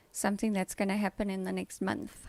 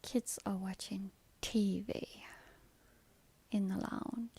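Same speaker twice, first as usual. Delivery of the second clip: whisper. Real, not acted. Kids watching TV nearby.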